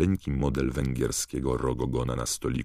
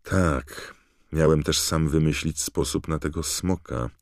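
plain reading voice

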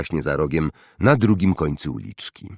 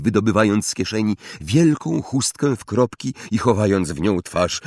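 plain reading voice

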